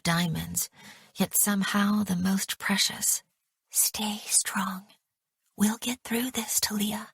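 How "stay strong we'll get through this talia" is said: hushed unvoiced reassurance; whisper. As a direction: whisper it close, so nobody else hears. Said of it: "I hear her whisper".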